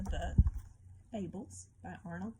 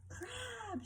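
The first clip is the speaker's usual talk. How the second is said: shouted call; 6 dB quieter than usual. A narrator's reading. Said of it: "shouted Lobster above the roaring wind".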